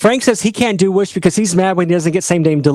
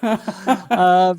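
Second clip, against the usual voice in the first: ordinary talk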